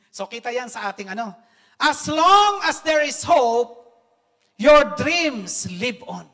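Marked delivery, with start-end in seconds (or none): 1.8-6.2 loud, very slow, each word weighed; emphatic quote. Shown as a demonstration. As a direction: drive the quote home slowly. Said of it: "repeated quote, louder and slower". (mabagal at madiing pagbigkas)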